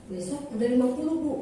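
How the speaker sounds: imitating astonished co-workers, rising at the end; mildly incredulous question, voice climbing; retold disbelief, lifting into a question; surprised question. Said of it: "Mereka juga heran".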